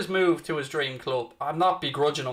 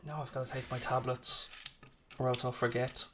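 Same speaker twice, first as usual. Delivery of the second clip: much softer, off-hand aside; quiet aside; 10 dB quieter than usual. Real, not acted. Streamer remembering to take tablets, quiet aside.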